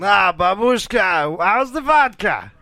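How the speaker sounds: put-on thick Russian accent. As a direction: talk like a hammy Russian. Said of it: "doing Russian accents lately".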